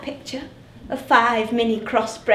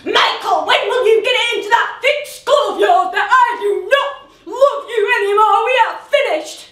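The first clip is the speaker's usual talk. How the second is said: retold yell; 8 semitones higher, 4 dB louder than usual. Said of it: "she just yelled at him".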